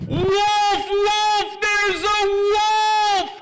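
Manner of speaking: high, drawn-out cry of wolf; long, sustained high yell; screaming alarm. Acted. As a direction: holler it from a mountaintop. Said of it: "he screams at the top of his lungs".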